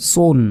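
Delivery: সোন said thick and heavy, with full mouth; heavy son. Shown as a demonstration. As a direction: make সোন round and heavy, the sad letter. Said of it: "সোন মোটা করে". (সোন মোটা করে ভারী উচ্চারণ)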